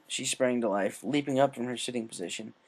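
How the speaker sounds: ordinary delivery, nothing marked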